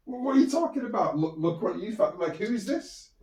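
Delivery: mock-baffled auntie; incredulous auntie. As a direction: demand answers, baffled. Auntie confronting about puppy.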